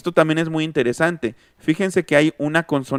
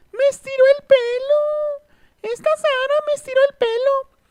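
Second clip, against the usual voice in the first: put-on crying little girl. Little girl whines to her parent about Sara. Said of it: "Si una niña está llorando".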